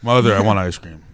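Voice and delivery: lightly voiced low, loud child asking Mother; hint of a kid with a man's voice; deep-voiced little kid. Deep-voiced boy begging mom for ice cream, mock childish.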